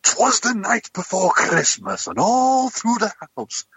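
high, drawn-out theatrical recital; acted up. Actor reciting the Christmas poem for a video, hammed-up and theatrical. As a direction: ham it up slowly, pitched right up. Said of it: "Proper acted it up".

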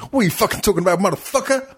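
high-pitched mock outrage; Samuel L. Jackson. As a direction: burst in with a hammed-up, rising challenge. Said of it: "Samuel L. Jackson sticks his head into the scene and goes".

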